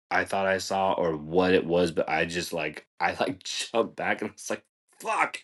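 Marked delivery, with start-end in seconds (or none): 5.0-5.4 retold jolt of fright; startled curse. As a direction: yelp it high, as if just spooked. Retells jumping back startled.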